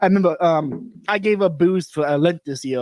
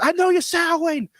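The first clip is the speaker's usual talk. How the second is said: imitated yell; 14 semitones higher than usual. Belloc kicks the door at Chesterton, mock yell. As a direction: imitate someone yelling through a door, pitch way up. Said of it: "yelling at Chesterton".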